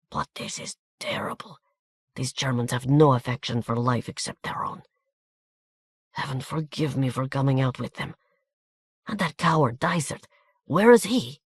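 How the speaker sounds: soft hushed Hungarian voice, flaring on the last question; hushed lament in a Hungarian accent; whispered commentary. A narrator's reading. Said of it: "a long whispered commentary".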